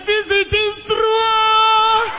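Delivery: high, loud and very slow; slow emphatic confirmation. Retold or imitated. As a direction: drag out every word to insist it's true. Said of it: "I said".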